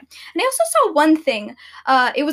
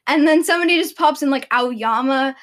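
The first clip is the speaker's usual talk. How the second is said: ordinary talk, nothing marked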